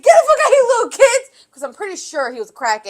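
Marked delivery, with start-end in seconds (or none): 0.0-1.3 high loud yell with laughter; laughing yell. Retold or imitated. Crackhead chasing kids out, laughing high yell. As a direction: yell them out, much higher, laughing. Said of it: "Guys, like".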